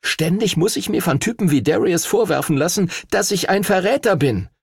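high, aggrieved rant; frustrated outburst. A narrator's reading. Henry to his dragon about accusations, frustrated. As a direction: vent the built-up grievance.

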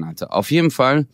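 usual speaking voice

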